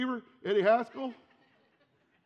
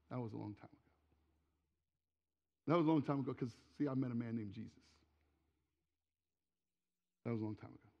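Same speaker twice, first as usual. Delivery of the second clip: much softer and lower, a quiet, settled echo of the line; hushed preaching refrain; 8 semitones lower, 7 dB quieter, faster than usual. Acted. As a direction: let the past rest, quietly.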